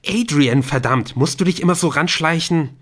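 high, loud startled yelp at Adrian; rattled, half-yelled reproach; startled outburst. Acted. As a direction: jump out of your skin and snap at him. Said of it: "Ihm entfuhr ein unterdrückter Schrei".

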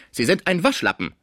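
high-pitched, mildly angry rebuke; unwillig. A narrator's reading. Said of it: "meinte Villemot unwillig". (leicht verärgerter Vorwurf)

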